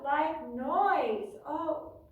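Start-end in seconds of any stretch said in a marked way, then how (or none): none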